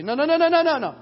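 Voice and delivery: high, loud, insistent no no no; mock world hammering refusal; loud repeated no. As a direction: shout down the listener like the pushy world. The world pressing its way.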